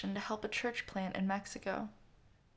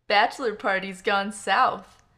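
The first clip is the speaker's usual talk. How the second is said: put-on drunk, slurred and loud; slurring drunk; 11 dB louder than usual. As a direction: slur it like a drunk announcing. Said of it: "announced, slurring his words".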